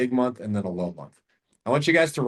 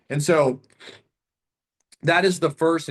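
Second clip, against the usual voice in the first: usual speaking voice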